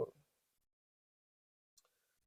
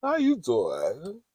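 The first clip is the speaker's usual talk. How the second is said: drawn-out, higher greeting swooping to a rise; slow flirty come-on; 6 semitones higher, 5 dB quieter, much slower than usual. Shown as a demonstration. Playful greeting for the man she fancies, slow and flirty. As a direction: stretch the pickup line out teasingly.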